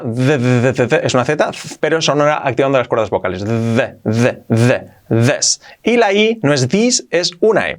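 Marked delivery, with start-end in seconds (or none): 0.0-1.1 z buzzed with voiced vocal cords; voiced z sound. Shown as a demonstration. Teacher demonstrating voiced z. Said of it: "La Z sonora". 3.4-5.6 Z, z, z, then zes buzzed slowly; voiced z buzz. Shown as a demonstration. Teacher shows how this starts. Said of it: "La Z sonora".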